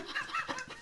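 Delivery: squeaky giggle; very high laugh. Real, not acted. Laughing at dad's exit, squeaky high giggle.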